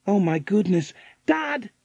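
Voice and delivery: high, alarmed call. A narrator's reading. Girl calling her father after finding the empty bowl, calling out. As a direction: call for Dad, pitch leaping up. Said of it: "she called".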